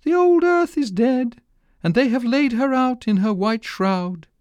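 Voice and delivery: high, chirpy little bird voice. A narrator's reading. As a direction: speak high like a small fussy bird. Linnets speaking.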